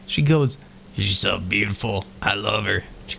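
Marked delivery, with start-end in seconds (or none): 1.0-2.8 lower, softer rock creature voice; a lumbering golem, gently besotted; deep creature voice. Acted. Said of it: "that was horrible voices".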